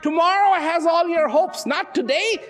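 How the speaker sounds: ordinary talk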